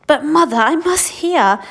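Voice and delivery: put-on high, loud pleading boy; pleading child. Charlie begging his mother, high pleading boy voice. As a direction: beg to stay up.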